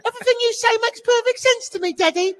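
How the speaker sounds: a high mock British kid's voice; posh British child. Host mocking his child self trusting dad, British accent, high. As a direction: play a prim British child adoring his father. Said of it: "and you're British".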